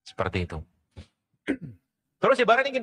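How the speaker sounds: ordinary talk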